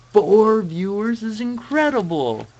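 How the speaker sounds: loud, high, drawn-out cheer; exultant celebration. Pretend. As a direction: proclaim it slowly at the top of your voice. Celebrates having four viewers.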